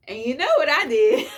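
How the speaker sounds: bursting into delighted laughter; proud giddy laugh. Real, not acted. Excited reveal of a clever trick.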